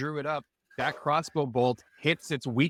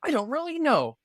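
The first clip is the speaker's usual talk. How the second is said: high, clueless little bird; innocent bird voice; 9 semitones higher than usual. Acted. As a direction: play it wide-eyed and chirpy.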